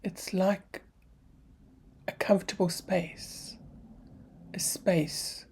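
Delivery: soft, unhurried meditation voice with long pauses; guided meditation. Real, not acted.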